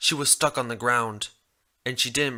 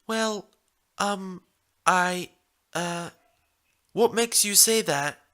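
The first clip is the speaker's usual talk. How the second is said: high, halting, caught-off-guard mare; stuttering young mare; 7 semitones higher, slower than usual. Acted. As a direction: stammer it out, flustered and high. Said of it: "She stuttered before she actually replied".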